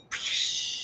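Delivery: soft whooshing "pshhh" noise; imitated wave crash. Streamer retelling a wave flooding over them, imitated crash. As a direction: mimic a wave washing over the top. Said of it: "and just like".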